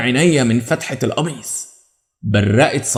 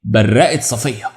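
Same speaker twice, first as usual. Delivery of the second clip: ordinary delivery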